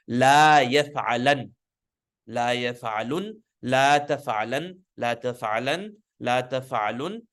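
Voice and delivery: slow, careful demo. Shown as a demonstration. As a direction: spell out "La yaf'alan" slowly for the class. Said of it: "make it light".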